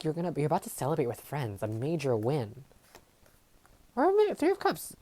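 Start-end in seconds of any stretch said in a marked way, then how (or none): 3.9-5.0 excited high exclamation. Real, not acted. Excited correction while reading cards.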